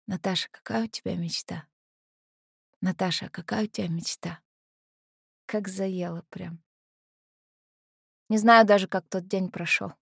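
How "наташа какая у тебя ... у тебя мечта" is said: soft, inward repetition; much quieter, like words circling in the head; echoing thought. Acted. Heroine replaying his question in her head, softly musing. В голове всё вертится.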